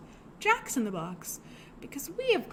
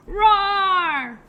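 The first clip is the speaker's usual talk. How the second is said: big, loud, higher lion roar; 7 semitones higher, 11 dB louder than usual. Pretend. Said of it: "we'll roar at our lion friend".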